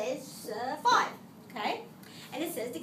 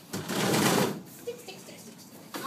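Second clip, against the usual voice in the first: muttering; 5 semitones higher, much slower than usual. Real, not acted. Searching for a stick.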